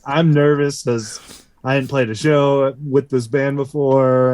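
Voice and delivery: normal talking voice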